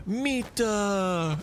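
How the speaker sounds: high, shouted Finnish callout; imitating enemy AI. Streamer mimics the enemy soldier's high yell. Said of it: "And then he goes, he goes".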